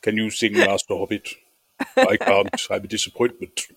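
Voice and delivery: low-pitched Lord of the Rings steward; low, put-on fantasy ruler; Jon Noble. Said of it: "Jon Noble from Lord of the Rings".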